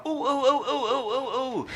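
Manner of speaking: high giddy ooh ooh, bouncing with joy; childlike excited cries; excited squealing. Retold or imitated. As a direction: squeal like a thrilled kid. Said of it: "And I was so excited".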